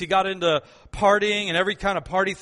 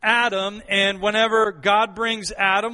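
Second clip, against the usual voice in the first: ordinary talk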